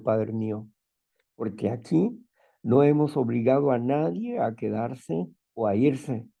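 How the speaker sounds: normal talking voice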